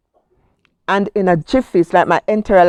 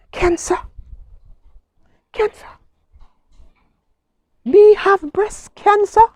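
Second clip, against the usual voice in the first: rising, stunned questions of disbelief; shocked disbelief; 11 semitones higher than usual. Retold or imitated. Woman reading her biopsy report, rising stunned disbelief. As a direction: say it as if you can't believe the word.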